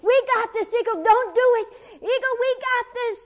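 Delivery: high, loud frantic cries; high, loud animals crying out in panic; panicked shouting. Acted. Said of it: "all of them were going".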